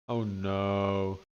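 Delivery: ordinary delivery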